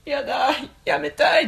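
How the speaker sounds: high whiny protest; imitating whiny protest. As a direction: squeal it like a reluctant wife. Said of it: "って言ってたよね".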